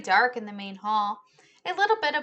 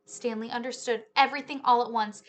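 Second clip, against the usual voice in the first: usual speaking voice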